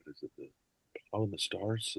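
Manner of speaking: normal talking voice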